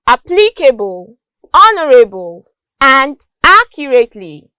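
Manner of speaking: "applicable, honorable, accurately", each stressed at the start; first-syllable stress. Shown as a demonstration. Teacher listing examples, first syllables of "applicable, honorable, accurately" stressed. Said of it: "words stressed on the first syllable".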